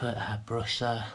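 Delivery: normal talking voice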